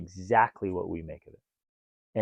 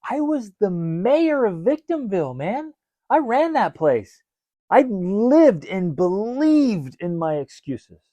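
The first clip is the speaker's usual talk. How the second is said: high, loud, mock-grand boast; hammed-up boast as the mayor of Victimville; mock proud mayor; 9 semitones higher, 7 dB louder, slower than usual. Husband boasting to listeners he ran Victimville, hammed up.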